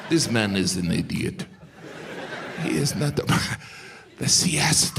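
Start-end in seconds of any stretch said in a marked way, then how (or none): 2.6-5.0 "siesta" whispered softly; reverent whisper. Acted. Comic admiring the siesta, reverent whisper. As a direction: hush it lovingly.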